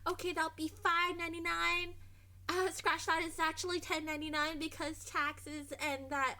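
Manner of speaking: sing-song service voice well above normal pitch; high customer voice. Retold or imitated. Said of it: "my customer voice is talking like this and really, really high".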